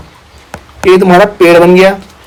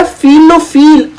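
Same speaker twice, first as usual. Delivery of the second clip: with feeling; 5 semitones higher, much slower than usual. Shown as a demonstration. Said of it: "feel लेके पढ़ते हैं बेटा".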